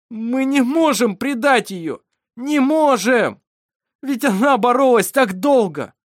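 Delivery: loud, high cry of helpless anguish; nearly sobbing. A narrator's reading. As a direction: cry out in helpless despair, close to sobs. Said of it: "Даниэль почти рыдал от бессилия". (отчаянный крик, почти рыдание)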